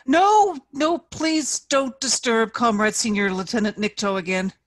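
almost shout it, begging; young officer almost shouting to his sergeant; near shout. A narrator's reading. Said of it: "Matvey almost shouted".

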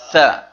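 ثاء said loud with the tongue between the teeth; clear letter name. Shown as a demonstration. Teacher models the letter ثاء loudly for students. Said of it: "Who can pronounce this letter?".

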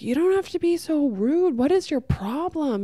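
high-pitched, whiny imitation of an offended man; mock indignant complaint. Man rebuffed on the beach, mock-indignant and high. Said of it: "really verbally aggressive with him".

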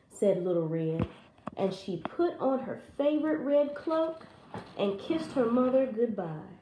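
ordinary delivery, nothing marked

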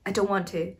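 usual speaking voice

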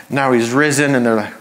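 normal talking voice

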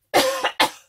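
loud choking cough; coughing fit. Real, not acted. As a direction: cough it out hard. Still choking after swallowing wrong.

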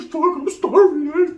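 high, loud, slow groaning complaint; hungry complaint. Retold or imitated. Locked-down inmate groans to his cellmate about hunger, high and slow. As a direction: moan it like you're wasting away.

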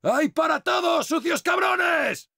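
high, drawn-out war cry; taunting battle shout. Acted. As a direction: shout it gleefully at the enemy, stretching the end. Taunts enemies while shooting.

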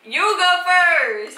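high, loud, slow insistence; playful shout. Real, not acted. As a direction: push her to go first, laughing.

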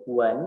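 WEN starting low and rising; second tone. Shown as a demonstration. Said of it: "nada dua". ("Wen" nada dua, naik dari rendah)

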